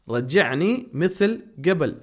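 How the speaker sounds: رجعنی مثل قبل drawn out slowly, stress on رجعنی; slow repeat. Shown as a demonstration. Teacher repeating the phrase slowly for students. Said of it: "ہم کہتے ہیں".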